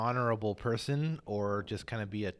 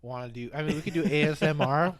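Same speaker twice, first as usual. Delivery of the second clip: chewing while talking; mouth full; 5 semitones higher, 8 dB louder, faster than usual. Real, not acted. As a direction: speak through a mouthful of crackers. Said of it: "I'm chewing these crackers".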